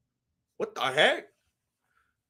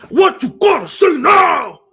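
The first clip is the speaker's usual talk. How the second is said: loud, slow, higher taunt; aggressive fighter; 6 semitones higher, 8 dB louder, slower than usual. Retold or imitated. Streamer acting the winner taunting his doubters. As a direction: bark it out like a fighter who just won. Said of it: "being all aggressive".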